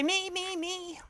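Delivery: high, soft mock-eager voice; small high pretend answer; playful volunteer. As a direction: pipe up quietly like an eager newcomer. Voices viewers answering her question.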